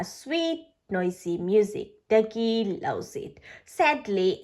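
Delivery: ordinary delivery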